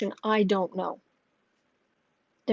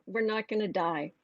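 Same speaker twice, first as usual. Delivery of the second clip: soft reassurance; 9 dB quieter than usual. Retold or imitated. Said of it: "I'm like".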